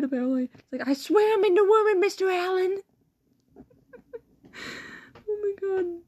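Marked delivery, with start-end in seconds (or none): 0.9-2.8 mock pleading student. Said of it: "This is what he said".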